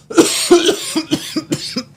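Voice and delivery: strained cough. Real, not acted. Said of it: "Hurt my voice".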